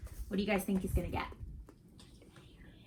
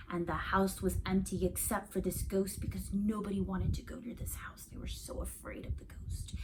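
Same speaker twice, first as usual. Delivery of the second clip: hushed, spooky whisper; ghost story whisper; 8 dB quieter than usual. Acted. Child whispering a ghost story in the dark, hushed.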